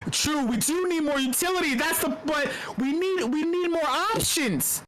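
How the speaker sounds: normal talking voice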